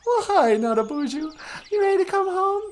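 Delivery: very high squeaky puppet wife greeting. Acted. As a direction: chirp it in a tiny high voice.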